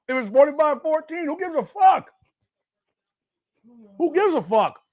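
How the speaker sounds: high-pitched exasperated questioning; exasperated rant. Real, not acted. Ranting about running up score.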